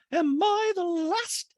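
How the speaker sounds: very high, drawn-out affected voice; Dorit. Retold or imitated. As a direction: stretch it out in a high put-on voice. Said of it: "we're legally allowed to imitate people".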